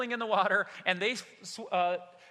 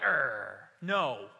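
lower, drawn-out awkward "Er, no"; sheepish hesitant no; 7 semitones lower than usual. Retold or imitated. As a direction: hesitate like caught empty-handed. Disciples answer sheepishly, retold.

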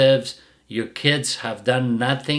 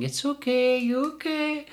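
very high soft coo; baby-talk the dog gently; mock owner's high soothing coo; 4 dB quieter than usual. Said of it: "You're saying".

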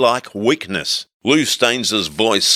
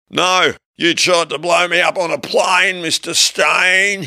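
high stoned accusation; 10 semitones higher than usual. A narrator's reading. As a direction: drag out the name mockingly. Hippie accuses, high and slow.

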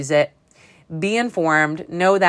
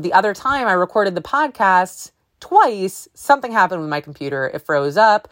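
ordinary delivery

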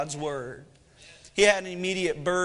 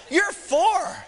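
high-pitched incredulous dad; comically exasperated parent, pitched way up; mock exasperation; 10 semitones higher, 4 dB louder than usual. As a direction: protest in mock disbelief. Dad's mock exasperation at daughter.

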